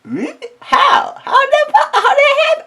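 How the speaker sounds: high squeaky disbelief, way up; mock baffled squeak. Retelling his reaction, a squeaky baffled falsetto. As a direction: ask it in a tiny shocked falsetto.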